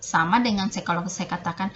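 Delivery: ordinary delivery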